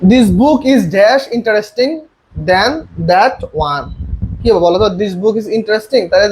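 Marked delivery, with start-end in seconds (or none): 0.1-3.9 slow dictation. Shown as a demonstration. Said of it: "দেখো".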